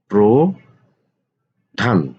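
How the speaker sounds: প্রধান stretched very slowly, syllable by syllable; drawn-out spelling. Shown as a demonstration. Teacher to students, slowly stretching প্রধান. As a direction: draw out প্রধান slowly so students hear each part. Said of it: "teacher stretches word for students".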